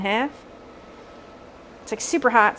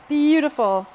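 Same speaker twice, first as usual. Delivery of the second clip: delighted; 5 semitones higher, 8 dB louder, slower than usual. Real, not acted. Admiring the roasted potatoes.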